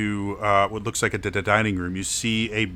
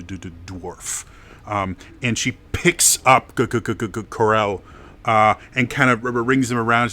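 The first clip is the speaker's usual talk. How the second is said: normal talking voice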